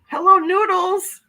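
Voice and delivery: high, loud, slow sing-song hello; delighted greeting. Real, not acted. Vlogger greets a chat regular, high delighted sing-song. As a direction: greet a favourite viewer with delight.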